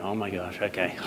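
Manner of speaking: small, low, hushed dread; half-joking frightened murmur; mock scared. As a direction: say it softly as if doomed. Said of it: "I was so scared".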